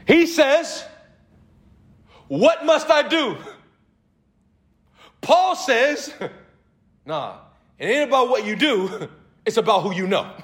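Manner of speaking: loud, high, driving preacher voice; rising sermon fervour; full-force preaching. Acted. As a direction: preach it hard to the congregation. Preaching the dialogue.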